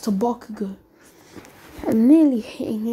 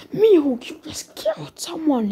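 higher-pitched appalled exclamation; shocked protest; 5 semitones higher than usual. Acted. All Might confronts Mihawk over the killing, shocked. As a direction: cry out the name, then protest in disbelief.

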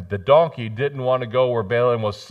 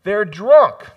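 mocking dismissal; 9 semitones higher, 10 dB louder than usual.

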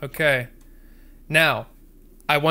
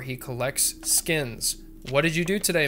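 usual speaking voice

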